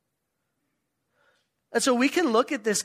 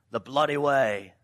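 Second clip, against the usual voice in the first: mock English accent, lower pitched; 4 semitones lower than usual. Shown as a demonstration. Preacher giving the road's nickname to the congregation, in an English accent. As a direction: put on an English accent for the phrase. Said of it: "say it in an English accent".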